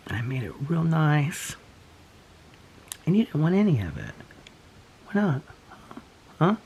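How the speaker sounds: pet talk. Real, not acted. Scolding pet gently.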